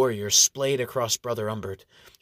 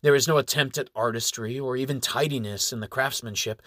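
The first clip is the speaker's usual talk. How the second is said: ordinary delivery, nothing marked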